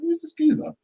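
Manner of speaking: thinking it again, high and startled; surprised. Retold or imitated. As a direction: squeak out the question in shock. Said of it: "I was like".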